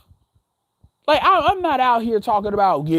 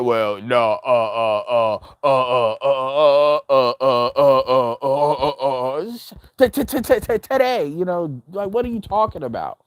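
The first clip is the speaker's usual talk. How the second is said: low, stammering mock voice; stuttering troll voice; 7 semitones lower than usual.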